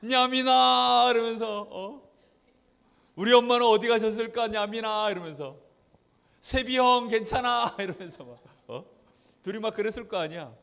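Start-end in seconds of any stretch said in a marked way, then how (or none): 0.0-1.1 high, loud, long-drawn call to little brother; acted wailing. Same wail repeated. 3.1-5.1 loud, plaintive call to little brother; acted lament. 6.4-7.8 high, slow, drawn-out concern; little brother's mock cry. Little brother crying back.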